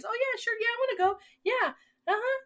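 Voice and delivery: very high, mock-friendly voice; fake agreeable. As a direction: play along in a squeaky-high, over-agreeable voice. Mimics inmates playing friendly homies.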